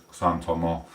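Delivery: normal talking voice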